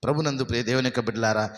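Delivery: normal talking voice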